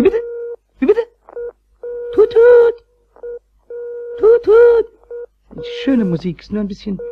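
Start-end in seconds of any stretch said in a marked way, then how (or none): 2.1-5.6 high, loud "tut, tut" like a phone line; playful dial-tone imitation; tooting dial tone. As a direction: mimic the telephone's beep.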